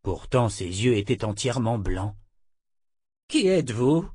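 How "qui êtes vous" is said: higher-pitched puzzled question. A narrator's reading. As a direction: ask it with rising puzzlement. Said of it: "demanda-t-il". (interrogatif, voix plus aiguë)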